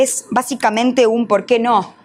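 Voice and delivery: usual speaking voice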